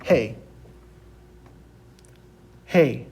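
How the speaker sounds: Hey for Spanish G, falling; slow model pronunciation. Shown as a demonstration. Teacher has students repeat the letter G, clear demonstration. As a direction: say Hey crisply, each one apart. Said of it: "So repeat after me".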